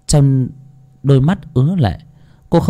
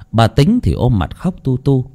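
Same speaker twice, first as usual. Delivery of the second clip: usual speaking voice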